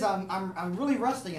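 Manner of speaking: ordinary talk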